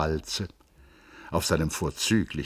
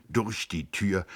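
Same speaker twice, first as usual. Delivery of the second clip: plain reading voice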